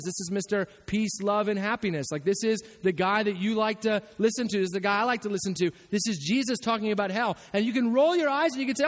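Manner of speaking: ordinary talk, nothing marked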